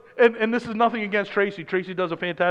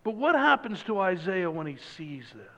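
usual speaking voice

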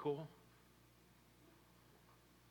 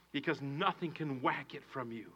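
ordinary talk

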